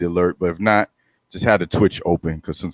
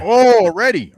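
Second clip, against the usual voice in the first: high, sudden "oh, already"; surprised; 14 semitones higher, 6 dB louder than usual. Real, not acted. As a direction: caught off guard by the news. Learns the release is next week.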